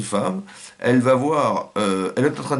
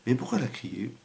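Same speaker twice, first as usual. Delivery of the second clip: ordinary talk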